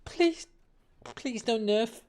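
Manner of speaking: squeaky high-pitched begging, please don't nerf; high pleading whine. Real, not acted. Begging the game not to nerf.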